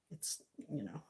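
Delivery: unvoiced whisper; quick whispered aside. Real, not acted.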